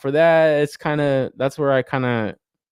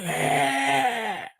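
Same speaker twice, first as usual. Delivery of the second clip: high, drawn-out "ehhh" cry; mock statue scream; 13 semitones higher, 4 dB quieter than usual. Reviewer imitates the Dwayne Wade statue, mock scream. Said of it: "that Dwayne Wade statue".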